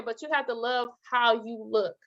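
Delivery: usual speaking voice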